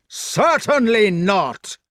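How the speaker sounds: slow, high, loud refusal; shouted refusal. A narrator's reading. Fat knight refusing his foe a fair fight, bellowing. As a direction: bellow it word by word.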